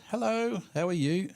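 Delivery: high, singsong hello; high-pitched cheery greeting; greeting a chat member. Real, not acted. Host greets a viewer who just said hello in chat, high singsong. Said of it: "has just said hello".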